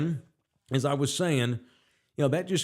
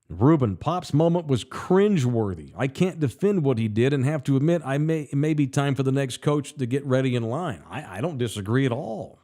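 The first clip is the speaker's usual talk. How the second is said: usual speaking voice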